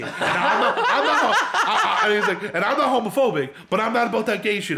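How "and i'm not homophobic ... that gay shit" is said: higher, louder imitated complaint; angry rant. Friend ranting down the phone to staff, angry rant. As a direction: push it out as an indignant tirade. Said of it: "he goes on this rant".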